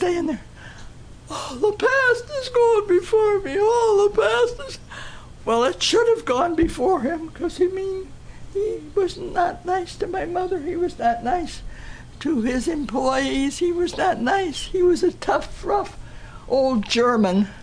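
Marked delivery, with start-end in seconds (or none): 1.3-4.8 high, sobbing plea, voice breaking; crying. Retold or imitated. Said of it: "He was crying". 5.4-17.5 high, choked-up telling; emotional. Real, not acted. Emotional telling about cruel father.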